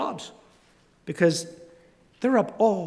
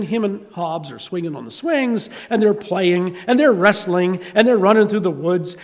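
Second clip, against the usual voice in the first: ordinary delivery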